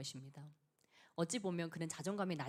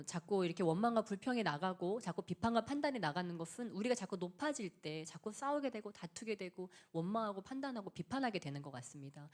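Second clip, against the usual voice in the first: normal talking voice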